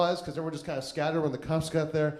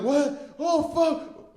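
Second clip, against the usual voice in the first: higher pitched, jolted "What? Oh, fuck."; startled waking; 11 semitones higher, 3 dB louder, much slower than usual. Retold or imitated. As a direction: snap awake in disbelief. Waking after four hours passed out.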